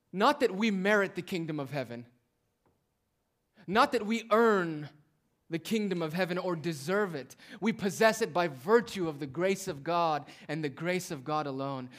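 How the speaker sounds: impassioned sermon voice; full-force preaching. Real, not acted. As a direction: drive the point home to the congregation.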